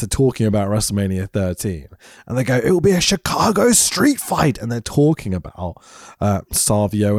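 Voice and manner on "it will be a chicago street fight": imitated commentator, raised and eager; hyped commentator. Host retelling the match announcement, mock commentator hype. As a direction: talk it up high like a commentator.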